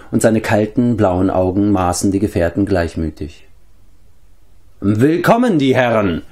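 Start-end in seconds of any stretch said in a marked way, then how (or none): 4.8-6.2 drawling greeting, pitched up then falling; drawled. A narrator's reading. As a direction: stretch out the welcome mockingly. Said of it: "sagte er gedehnt".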